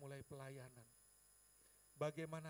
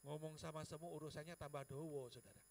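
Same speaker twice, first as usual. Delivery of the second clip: ordinary talk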